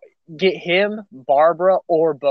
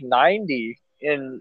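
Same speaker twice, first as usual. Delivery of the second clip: ordinary talk, nothing marked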